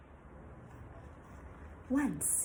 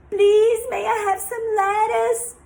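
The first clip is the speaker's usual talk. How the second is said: high loud pleading; begging girl; 10 semitones higher, 11 dB louder than usual. Acted. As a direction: beg for lettuce. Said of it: "she kept asking the witch".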